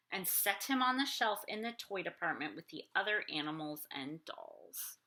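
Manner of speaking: put-on lively read-aloud for kids; storybook reading. A narrator's reading. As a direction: read it like bedtime story time. Reading picture book aloud.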